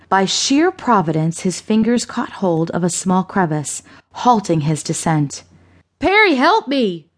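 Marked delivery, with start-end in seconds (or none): 6.0-7.1 high-pitched panicked plea for help; urgent plea. A narrator's reading. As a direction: call out desperately for rescue. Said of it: "he said without a stutter".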